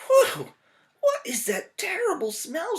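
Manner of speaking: high, loud cry of disgust at a stink; disgusted old lady cry. Acted. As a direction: recoil from the smell as a little old lady. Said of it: "she cried".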